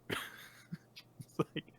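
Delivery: ordinary talk, nothing marked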